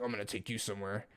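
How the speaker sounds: menacing hush. Retold or imitated. And he looked at him like that.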